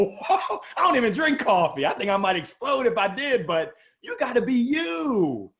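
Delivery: ordinary talk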